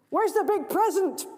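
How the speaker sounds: put-on high, loud greedy child; demanding boy. Greedy boy demanding his present, high loud. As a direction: whine it like a spoilt boy. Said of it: "asks the boy".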